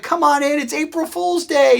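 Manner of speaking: high-pitched imitation of laughing colleagues; jovial calling. Laughing guys welcome the pranked new hire, imitated high jovial call.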